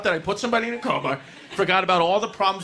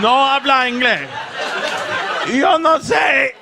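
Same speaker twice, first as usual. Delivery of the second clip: louder, higher fake Spanish, shouted; mock Spanish shouting; 5 semitones higher, 5 dB louder than usual. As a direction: pretend not to speak English, in a shouted fake Spanish. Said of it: "And I was like".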